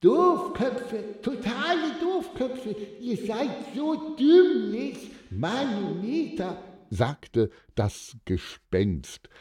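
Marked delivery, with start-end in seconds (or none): 0.0-6.6 high, drawn-out spook voice; scolding ghost. A narrator's reading. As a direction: chide them in a wailing ghost voice. Ghost scolds the surrendering crooks.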